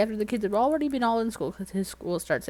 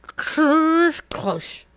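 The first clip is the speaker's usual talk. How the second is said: imitated crash; 7 semitones higher, 7 dB louder than usual. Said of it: "I hear".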